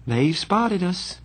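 put-on slow, high girl's voice; lightly voiced resigned girl. A narrator's reading. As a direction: announce it slowly, giving in. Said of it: "Cherry said resignedly".

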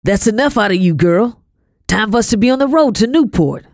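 snorting dismissal. A narrator's reading. Master dismissing the girl to leave for Newport, snorting dismissal. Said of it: "Mister Robert snorted".

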